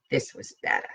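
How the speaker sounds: near-whispered, low and soft; hushed. Acted. T confides to the gang in a hushed voice. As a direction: keep it secret, barely voiced.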